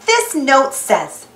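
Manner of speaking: usual speaking voice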